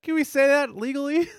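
high, loud cartoonish disbelief; incredulous cartoon voice. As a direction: squeak it out like a cartoon pig. Quoting a cartoon character's line.

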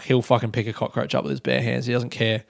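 ordinary talk

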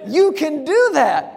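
high, falling, delighted confirmation; emphatic realization. Acted. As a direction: answer the question with amazed certainty. Realization answering his own question.

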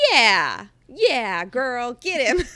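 loud, excited cheer. Retold or imitated. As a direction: egg her on loudly. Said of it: "I was like".

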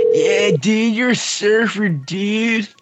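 high, drawn-out surfer-dude voice; California surfer accent. Acted. Friend teasing streamer, California surfer accent. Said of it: "like, I'm a fucking surfer".

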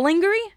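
lingerie as "LING-ger-ee?", high, loud and incredulous; mocked mispronunciation. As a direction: question lingerie with a hard g and rising "ee". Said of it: "don't have to say it like that".

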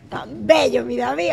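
ordinary talk, nothing marked

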